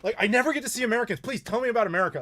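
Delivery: high, fast and eager; an excited driver who has never met Americans; suddenly excited. Retold or imitated. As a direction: burst into delighted questions. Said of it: "his attitude changed instantly".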